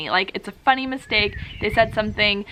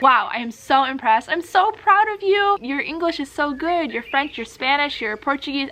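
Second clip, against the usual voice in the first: smiling encouragement; 4 semitones higher than usual. Acted. Host praises an exchange student's language skills, gushing encouragement. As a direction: cheer the student on with big, proud enthusiasm. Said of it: "if you are able to smile and say".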